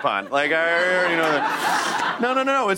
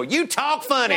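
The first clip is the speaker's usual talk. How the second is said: higher-pitched mock Texas twang; Texan accent; 6 semitones higher than usual. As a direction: put on a Texan accent for a teasing jab. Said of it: "This is where the Texas in you".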